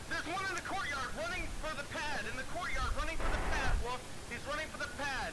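high, urgent callout to the team; excited callout. Real, not acted. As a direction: shout the enemy's position fast. Spotting enemy in firefight.

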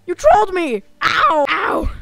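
high, loud, eager puppy voice; excited high voice. Acted. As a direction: beg to play catch like a dog. Excited ragdoll wants to play catch.

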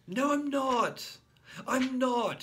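very high loud slow wail; little boy shouting no in distress; tearful boy protest. A narrator's reading. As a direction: protest on the edge of tears. Child protesting near tears.

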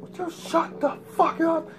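high, loud, drawn-out retort; angry shout back. Retold or imitated. Him to an adult bunkmate in boot camp, angry shout. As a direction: yell it slowly, hitting each word.

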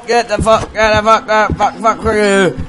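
high, loud enraged curses; furious screaming. Real, not acted. Fighting off the monster.

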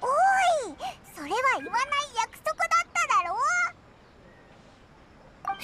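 flustered protest. Real, not acted. Protests at a teasing remark.

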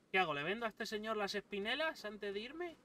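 normal talking voice

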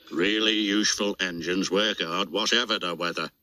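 low, firm, brisk controller voice; stern boss. Acted. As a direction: lay down the rule like a stern boss. Fat Controller answers sternly.

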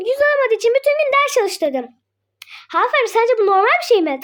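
usual speaking voice

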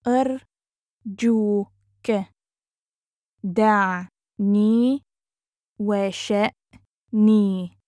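very slow, broken into separate syllables. Shown as a demonstration. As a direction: sound it out with long gaps. Teacher breaks phrase into syllables.

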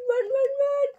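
high squealed urging; mock panic. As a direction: squeal it as if fleeing. Acting out the frightened running away.

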